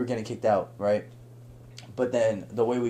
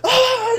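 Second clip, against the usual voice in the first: high, loud mock war cry; short acted-out group holler; imitated battle yell; 10 dB louder than usual. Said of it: "I start yelling with them".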